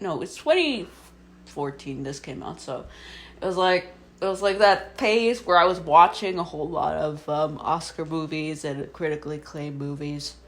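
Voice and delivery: ordinary talk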